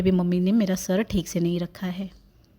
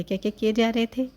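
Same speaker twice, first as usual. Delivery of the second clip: normal talking voice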